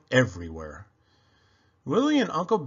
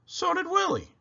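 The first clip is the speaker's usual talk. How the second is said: playful high. A narrator's reading. Playful repeat.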